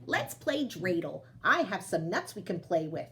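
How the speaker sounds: ordinary delivery, nothing marked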